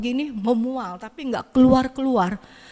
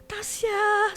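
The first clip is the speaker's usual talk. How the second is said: much higher call across the yard; 11 semitones higher, 4 dB quieter than usual. Retold or imitated. Grandmother calls the neighbor's granddaughter outside, high call. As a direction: call the girl from the house.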